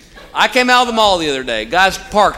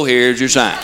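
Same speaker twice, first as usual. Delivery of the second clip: catchphrase; 6 semitones lower, slower than usual. Shown as a demonstration. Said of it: "we just go".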